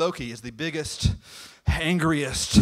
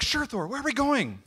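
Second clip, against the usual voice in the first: chirpy goddess; 10 semitones higher than usual. Acted. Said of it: "She's like".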